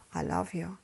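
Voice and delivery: soft, tender murmur; loving. Acted. Grandmother turning back to the baby, soft and loving. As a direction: say it gently, as a smile would. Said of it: "what the smile says".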